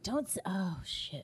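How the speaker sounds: retold under-the-breath curse; very soft, whispered oath; hushed mock dismay. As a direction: trail down into a whisper, imitating husband. Retelling husband's hushed reaction.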